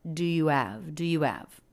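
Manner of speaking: "Do you have?" fast, with "do" and "you" blended; quick and reduced. Shown as a demonstration. Teacher showing the natural quick version. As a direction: toss the three words off quickly.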